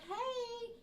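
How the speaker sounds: high, drawn-out parent voice; gentle parent voice. Retold or imitated. Parent addressing children in retold scene.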